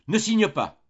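high, loud cry of "Ne signe pas". A narrator's reading. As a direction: shout it to stop her signing. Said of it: "cria le père Goriot".

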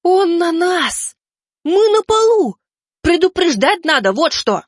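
high, loud hysterical shriek; toddler tantrum. Acted. Ormond shrieks after the fright.

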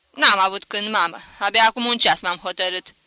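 emphatic, loud self-defence; loud excuse. A narrator's reading. Son defending his sudden decision, loudly protesting. Son answers mother's scolding.

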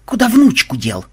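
loud, high stern demand; sharp interrogating question. A narrator's reading. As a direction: demand an answer severely. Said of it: "сурово спросил Ксенобайт".

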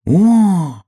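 high, loud "oh" of wonder; astonished piglet; amazed. A narrator's reading. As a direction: gasp out a big high "oh".

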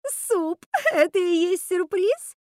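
very high, loud squealed question; incredulous squeal. A narrator's reading. Martha scoffing at the soup surprise, incredulous squeal. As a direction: squeal in mocking disbelief. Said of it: "взвизгнула Марта".

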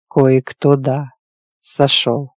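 vague, low, drawn-out reply; noncommittal drawl. A narrator's reading. Werewolf admitting some reacted badly, noncommittal drawl. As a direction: let the words trail out evasively. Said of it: "Неопределённо протянул оборотень".

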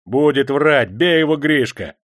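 taunting, high-pitched urging; egging on. A narrator's reading. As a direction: goad the men to hit harder. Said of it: "подзадоривал егерей бородатый хват".

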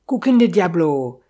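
a loud burst of sudden realisation; startled exclamation. A narrator's reading. The innkeeper suddenly remembers the bicycle, exclaiming loudly. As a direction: blurt it out loud as it hits him. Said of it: "s'exclama-t-il".

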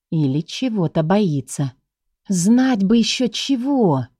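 plain reading voice

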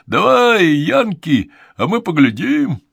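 high, loud jeering yell; heckler shout. Acted. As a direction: heckle the performer mockingly. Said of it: "загорланил горожанин".

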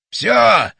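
loud, high single cry; shouting. A narrator's reading. Batya announcing the machine is ready, shouting. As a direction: shout it to everyone. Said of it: "закричал батя".

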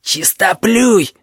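slow, high, strained insult; grunted out. A narrator's reading. Lary scorns squeamish Gorbach while straining, slow high grunt. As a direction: grunt it out with effort, drawn long. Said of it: "прокряхтел Лэри".

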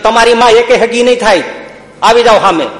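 high, loud dare to attackers; defiant challenge. Retold or imitated. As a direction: act out a young fighter daring the mob. હુમલાખોરોને સામે આવવા પડકાર. (હુમલાખોરોને ઊંચા અવાજે લલકાર)

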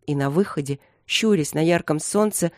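usual speaking voice

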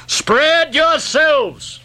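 high, drawn-out command; crying out. A narrator's reading. Ahab to the boat crews, crying out slowly. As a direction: call the order slowly over water. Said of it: "cried Ahab".

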